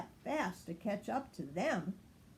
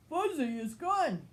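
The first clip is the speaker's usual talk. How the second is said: higher urgent pup voice; Fuzzy is gone; 6 semitones higher, 4 dB louder than usual. A narrator's reading. Marshall running to Ryder, higher and urgent. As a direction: alarm the boss. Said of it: "he said".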